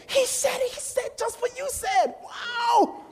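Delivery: hammed-up high squeal of amazement; amazed kid. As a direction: marvel at it in an excited, very high voice. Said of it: "the kid, the guy that brought us".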